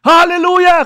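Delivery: high, loud hallelujah; exultant praise shout; shout to Jesus. Acted. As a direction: cry out praise at the top of the voice. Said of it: "Kailan ang last napasigaw ka kay Jesus?".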